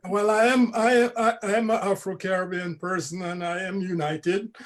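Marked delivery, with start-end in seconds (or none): none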